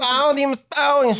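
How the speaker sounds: put-on very high, drawn-out Vietnamese-accent caricature; hammed-up Vietnamese accent. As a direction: imitate the vendor in a slow, high Vietnamese accent. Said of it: "Vietnamese booth, they're like".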